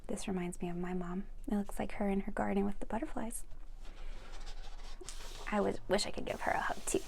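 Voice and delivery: breathy whispered storytelling, no voice; soft whisper reading. Acted. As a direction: whisper it close, like a secret bedtime story.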